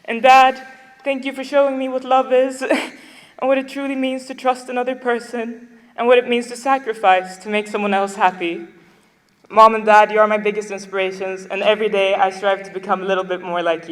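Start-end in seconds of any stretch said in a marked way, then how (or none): 0.1-11.5 real tears pushing through a laugh; emotional thanks to dad, voice breaking; getting emotional. Real, not acted. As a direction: voice pitched up and choked with feeling. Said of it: "I'm getting emotional".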